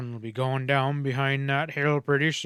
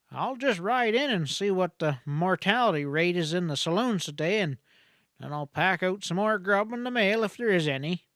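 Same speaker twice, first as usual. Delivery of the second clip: lightly voiced country doctor, a bit higher; a hint of a folksy frontier doctor, wry; 6 semitones higher than usual. A narrator's reading. Country doctor talks to Helen.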